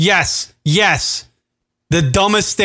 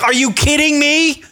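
indignant outburst; 7 semitones higher than usual. Retold or imitated. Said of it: "I'm like".

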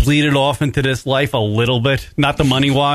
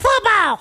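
high laugh. Real, not acted. Laughing at the story.

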